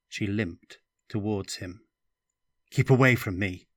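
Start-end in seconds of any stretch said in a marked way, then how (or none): 2.7-3.7 louder, backing away in fear; fearful shout. A narrator's reading. Stefan recoiling from Katya, a frightened shout. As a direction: keep her off with a shout.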